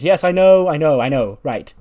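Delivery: animated reply. Retold or imitated. Excited recap of the dialogue.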